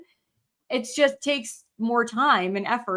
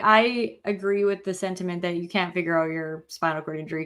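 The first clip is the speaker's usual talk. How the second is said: usual speaking voice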